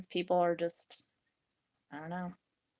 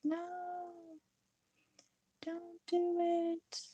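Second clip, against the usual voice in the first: high mock panic; 10 semitones higher, 8 dB quieter, much slower than usual. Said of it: "I was like".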